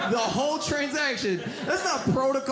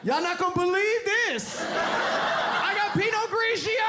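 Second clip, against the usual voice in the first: higher, excited party-bro shout; teen party bro; 9 semitones higher, slower than usual. Retold or imitated. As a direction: brag to the party, slowing on the last words. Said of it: "dude is showing up ... like".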